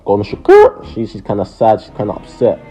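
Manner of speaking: normal talking voice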